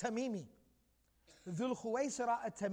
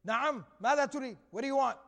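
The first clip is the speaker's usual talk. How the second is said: quick, louder, acted-out "what is it?"; brusque retold reply; 3 semitones higher, 6 dB louder, faster than usual. Prophet answers the visitor in kind, brusque. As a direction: answer back briskly in kind, voice raised.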